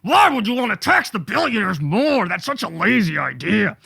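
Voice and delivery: lightly voiced huffy rich man; hammed-up offended billionaire; mock indignation. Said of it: "notice the fire and the passion and the anger".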